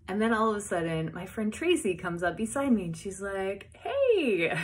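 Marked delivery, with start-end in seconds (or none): 3.7-4.6 high, loud squealed hello; delighted greeting. Retold or imitated. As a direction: call out thrilled to a friend. Friend greets her in line.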